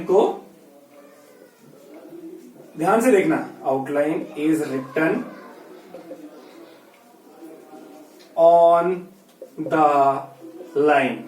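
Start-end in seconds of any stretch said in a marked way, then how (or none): 3.6-11.2 very slow, word by word; spaced-out dictation pace. Shown as a demonstration. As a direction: dictate it so students can write. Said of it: "dictating the rule slowly".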